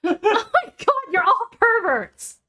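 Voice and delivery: high, laughing disbelief. Real, not acted. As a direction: laugh it out in mock outrage. Laughing mock outrage at friend.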